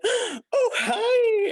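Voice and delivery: gasp, then a very high squealed greeting; super excited. Retold or imitated. Hiring manager greeting a candidate, super excited. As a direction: imitate an overjoyed welcome. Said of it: "super excited".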